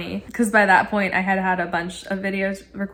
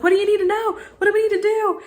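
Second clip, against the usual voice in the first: high-pitched, excited questions; eager; 11 semitones higher, faster than usual. Retold or imitated. Customer running to computer for tech, eager.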